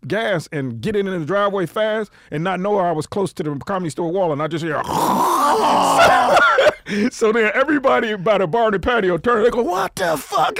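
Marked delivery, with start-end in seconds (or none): 4.8-7.1 loud, high drawn-out scraping screech; imitated crash scrape. Retelling the car hitting the Comedy Store wall, imitated screech. 9.6-10.6 high-pitched mock crowd shock; shocked outcry. Patio crowd turning at the crash, shocked outcry. Acts out bar crowd reacting.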